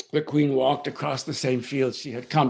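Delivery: ordinary delivery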